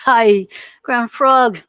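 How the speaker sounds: drawn-out, loud laughing hello; loud, slow laughing welcome; laughing greeting. Real, not acted. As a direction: greet him with a big laugh, slowly. Greeting first viewer with a laugh.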